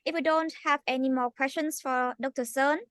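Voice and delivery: ordinary talk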